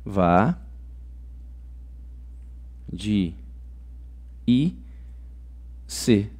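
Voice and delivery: each syllable alone, "va", "de", "i", "c", long pauses between. Shown as a demonstration. Teacher splitting vadiice for students, "va, de, i, c" syllable by syllable. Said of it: "Na palavra vadiice".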